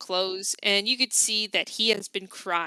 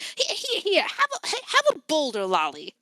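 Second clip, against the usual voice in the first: sweet auntie voice; 5 semitones higher than usual. Acted. Auntie offering candy.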